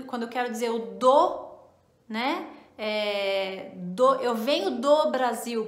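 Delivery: usual speaking voice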